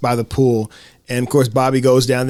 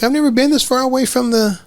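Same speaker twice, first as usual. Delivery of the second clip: put-on kid voice; 8 semitones higher than usual. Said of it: "And she's like".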